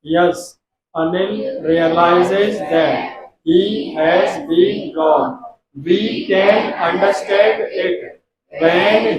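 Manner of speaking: slow, stretched English reading of the passage; slow read-aloud. Shown as a demonstration. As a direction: read it slowly while students only listen. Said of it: "पूरा सुनना केवल". (धीरे धीरे पूरा पढ़कर सुनाना)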